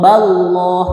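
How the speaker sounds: BALLAH with a thick, held L, ending "lloh"; heavy lam. Shown as a demonstration. Teacher demonstrating after fathah, heavy lam in BALLAH. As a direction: say "ballah" slowly, dark and rounded on the L. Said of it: "Contoh yang dibaca berat".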